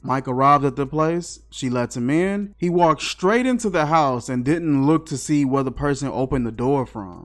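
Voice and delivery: ordinary delivery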